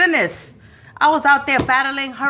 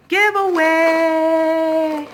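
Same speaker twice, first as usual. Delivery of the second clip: giveaway drawn out very slowly; slow hyped drawl on giveaway; stretched word; 4 semitones higher, much slower than usual. Acted.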